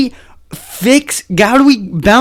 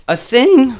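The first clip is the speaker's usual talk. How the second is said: voice crack; 4 semitones higher than usual. Real, not acted. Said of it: "a voice crack".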